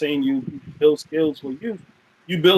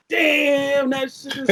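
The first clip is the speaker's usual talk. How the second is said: amazed; 11 semitones higher, much slower than usual. Retold or imitated. Impressed reaction to partner's art.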